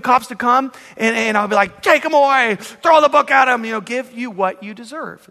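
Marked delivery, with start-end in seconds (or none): 1.8-3.6 raised, higher-pitched order to the police; mock outraged command; acted stern demand. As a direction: demand punishment with force. Acts out handing thief to cops.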